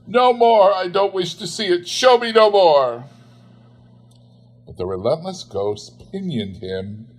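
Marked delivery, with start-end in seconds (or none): 0.0-3.0 much higher, louder cry; anguished cry. Acted. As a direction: cry out in torment. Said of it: "cried Scrooge".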